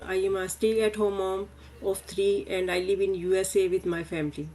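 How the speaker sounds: ordinary delivery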